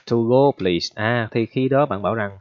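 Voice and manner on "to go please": "To go please" said louder, falling on "go"; louder demo, falling on "go"; clear model phrase. Shown as a demonstration. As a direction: finish the order firmly on "go". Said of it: "bạn bảo như vậy đó".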